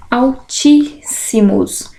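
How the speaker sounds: "Altíssimos" slowly split into syllables. Shown as a demonstration. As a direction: hold the tí in "Altíssimos", syllables apart. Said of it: "Separação de sílabas".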